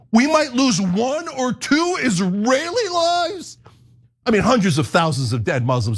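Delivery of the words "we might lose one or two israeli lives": much higher, slow, whiny mock panic; mock whining. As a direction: stretch out lives like a pampered complainer. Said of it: "They're like".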